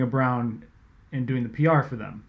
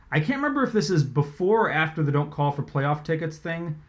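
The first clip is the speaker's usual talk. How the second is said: ordinary delivery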